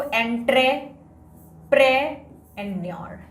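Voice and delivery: "एंट्रेप्रेन्योर" broken slowly into chunks; slow memorizing demo of "एंट्रेप्रेन्योर"; syllable by syllable. Shown as a demonstration. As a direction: pause between the parts of "एंट्रेप्रेन्योर". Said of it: "अब ऐसे याद करते हैं".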